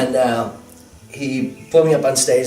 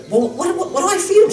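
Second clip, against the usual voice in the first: high, rising panicky wife quote; mock panic, climbing higher as she goes; wife panicking over what to feed the monk, high and rising; retold freak-out. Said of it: "starts freaking out".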